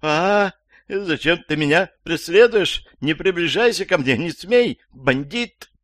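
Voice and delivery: put-on high, panicky old woman's outcry; frightened yelling. A narrator's reading. Old woman trapped in a lift with a stranger, yelling in fright. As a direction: cry out high in alarm, warding off an attacker. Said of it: "скороговоркой заорала старушка".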